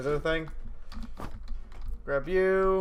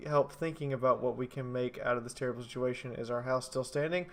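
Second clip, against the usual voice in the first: ordinary talk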